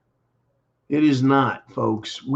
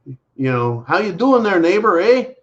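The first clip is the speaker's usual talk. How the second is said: a hint of a friendly Canadian accent; chirpy Canadian neighbor greeting; lightly mocked Canadian accent; 3 semitones higher, 3 dB louder than usual. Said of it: "the smile and the".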